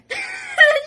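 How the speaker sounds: high, loud burst of laughter; cracking up at the memory, a high loud laugh; shrieking laugh. Real, not acted.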